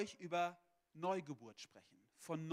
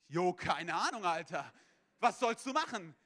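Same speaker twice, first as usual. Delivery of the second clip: loud, comic helpless outburst; overwhelmed exclamation; 9 dB louder than usual. Retold or imitated. Young intern reacting to a baffling caller, loudly overwhelmed. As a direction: blurt it out loudly, acting totally out of your depth.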